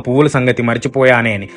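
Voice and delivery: ordinary delivery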